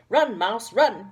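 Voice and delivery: chasing the mouse. Acted. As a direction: chase it off, loud and playful. Saucepan chasing the clockwork mouse.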